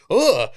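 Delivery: loud, high "ugh" of disgust; disgusted groan. Retold or imitated. Friend recoiling in disgust at the creepy audiobook, loud groan.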